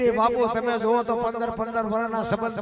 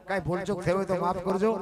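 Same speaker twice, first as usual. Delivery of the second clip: ordinary talk, nothing marked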